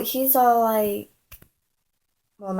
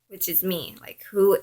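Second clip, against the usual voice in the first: ordinary talk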